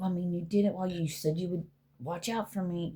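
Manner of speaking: ordinary delivery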